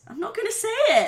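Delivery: high, fast, loud refusal; mock protest. Refuses to say potatoes.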